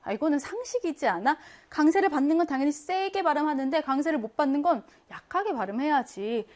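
ordinary talk, nothing marked

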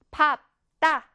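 밥 따 as two separate firm syllables; read-along pronunciation. Shown as a demonstration. Teacher leading pupils reading aloud, read-along pronunciation of 밥 따. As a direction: lead pupils through 밥, then tense 따. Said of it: "우리 한번 소리내어 읽어볼까요".

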